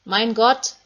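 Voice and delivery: loud cry, rising at the end; Jesus crying out on the cross. Acted. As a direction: call out to God in agony. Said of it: "Da hören sie Jesus schreien".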